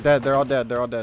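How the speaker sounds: loud, excited shout; excited callout. Real, not acted. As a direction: yell it out in triumph. Teammates wiped, calling it out.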